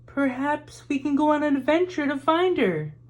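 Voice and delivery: put-on a bright girl with an idea; Em suggesting. A narrator's reading. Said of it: "said Em".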